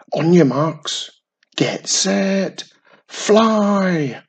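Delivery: high, loud starting call, building; excited shout. A narrator's reading. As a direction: shout the start like a race official.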